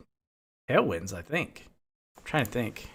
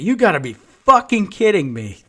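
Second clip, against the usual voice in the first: loud, high disbelieving yell; furious outburst; 7 semitones higher, 9 dB louder than usual. Retold or imitated. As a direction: shout it at the screen in disbelief. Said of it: "that's what I'm saying".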